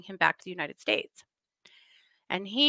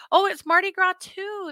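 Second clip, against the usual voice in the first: sudden realization; 10 semitones higher, 4 dB louder than usual. Real, not acted. Surprised reading chat.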